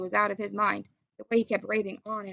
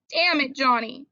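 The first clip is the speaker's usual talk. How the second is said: higher, louder anguished plea; anguished begging; 5 semitones higher, 6 dB louder, slower than usual. Acted. Dally begging dying Johnny, anguished plea. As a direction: beg him not to go. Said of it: "he begged".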